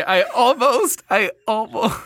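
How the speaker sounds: high-pitched, trying to speak through a laugh; cracking up mid-sentence; stifled laughter. Real, not acted. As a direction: fight back the giggles while talking. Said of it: "What are you laughing at?".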